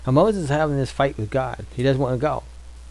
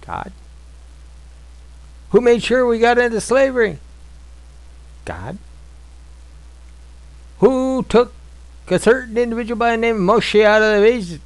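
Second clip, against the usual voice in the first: preaching; 6 semitones higher than usual. Acted. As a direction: drive home each question to the flock. Preaching rising to full force.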